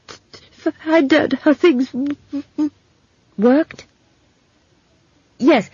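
high, halting voice, sticking on words; stammering young man. Acted. Simon telling Esk how he came to study, stammering. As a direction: stutter and fight to get each word out. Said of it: "when he talked, the spasm of agony".

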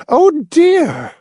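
very high-pitched matronly dismay; vexed. A narrator's reading. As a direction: exclaim in fussy vexation.

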